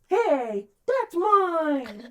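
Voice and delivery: high, possessive mock protest; hammed-up outraged whine; mock going off. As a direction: imitate someone indignantly claiming what's theirs. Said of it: "they all start going off like".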